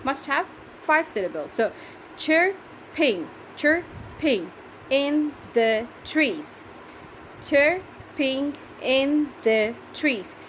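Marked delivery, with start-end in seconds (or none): 2.2-3.3 syllable by syllable. Shown as a demonstration. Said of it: "how do we count the syllables". 3.6-6.6 "chirping in the trees" in slow, separate beats; syllable by syllable. Shown as a demonstration. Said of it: "how do we count the syllables". 7.4-10.4 syllable by syllable. Shown as a demonstration. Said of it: "Can you count how many are they?".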